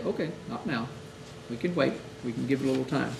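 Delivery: quiet, gentle and unhurried; soft reassurance. Shown as a demonstration. Chaplain quietly telling grieving family to wait, soft reassurance. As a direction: ease the family into waiting, softly.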